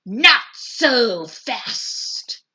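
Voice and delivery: slow, menacing hiss. A narrator's reading. Strict witch teacher stopping the kids, slow hiss. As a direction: drag each word out like a threat. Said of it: "hissed an unpleasant voice".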